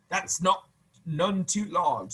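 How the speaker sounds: slow, weighty soldier's verdict; slow judging. Acted. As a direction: drag it out, mock serious.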